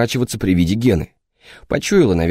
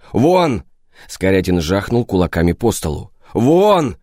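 shouting; slower than usual. Acted. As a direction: bark him out of the room. Boss throwing guard out.

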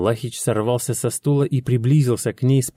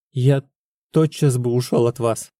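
ordinary delivery

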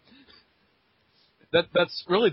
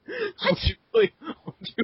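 laughing. Pretend. Laughing plea as office worker.

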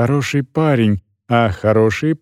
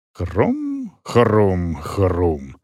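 crunch sounds; 6 semitones lower, 3 dB quieter, much slower than usual. A narrator's reading.

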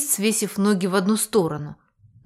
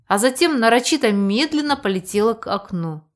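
plain reading voice

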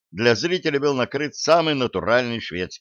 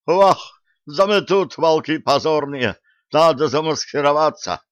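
panicked wolf; 6 semitones higher, 4 dB louder than usual. A narrator's reading.

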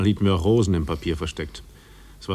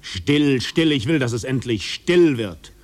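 louder, pleading for silence; pleading outburst; 4 semitones higher, 4 dB louder, slower than usual. Acted. Man begging the other to be silent, anguished outburst. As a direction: cry out for it all to stop.

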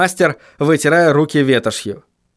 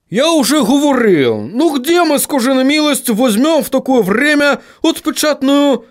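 high-pitched, protesting dwarf voice; exasperated dwarf; 10 semitones higher than usual. Acted. Dwarf answers William's demand for a press, exasperated. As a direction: protest high, as if asked the impossible.

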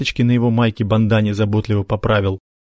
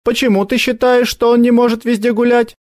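put-on challenging girl; 14 semitones higher, 4 dB louder than usual. Sonya challenging.

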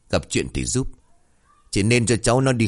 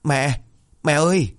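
high-pitched call for mother; 6 semitones higher than usual. A narrator's reading. Daughter running inside to her mother, calling out. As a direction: call out to Mom, raised pitch. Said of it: "lớn tiếng gọi".

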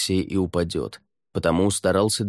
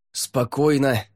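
raised, higher steadying call; shout; 6 semitones higher, slower than usual. A narrator's reading. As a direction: call out to keep her still. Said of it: "крикнул он".

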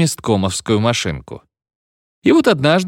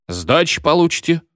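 hoarse; 7 semitones higher than usual. Acted. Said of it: "хрипло сказал Мервухин".

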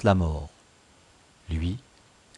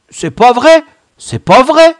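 high, loud panicked denial; panicked stammer; 15 dB louder than usual. A narrator's reading. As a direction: protest like a cornered culprit. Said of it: "balbutia le bancal". (balbutier, affolé, d'une voix aiguë)

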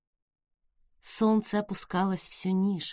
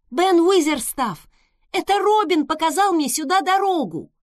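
higher, loud, out of breath; breathless shout; 8 semitones higher, 6 dB louder than usual. A narrator's reading. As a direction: shout up while panting. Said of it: "кричала ему наверх, всё ещё чуть задыхаясь".

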